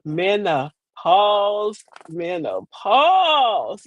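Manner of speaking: MENOPAUSE stretched out very slowly, syllable by syllable; drawn-out emphasis. Real, not acted. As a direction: draw out MENOPAUSE for dramatic weight. Dramatic announcement of the topic.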